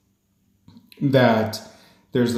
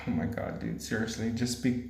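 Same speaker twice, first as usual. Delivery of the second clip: much softer self-mocking mutter under her breath; quiet aside; 11 dB quieter than usual. Real, not acted. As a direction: tease yourself quietly, half to yourself. Mocking her own fumbled words.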